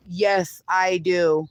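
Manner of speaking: usual speaking voice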